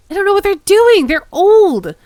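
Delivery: loud, high frustrated shout; shouting in disbelief; exasperated yell. Real, not acted. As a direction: yell at them to get on with it. Player yells at players.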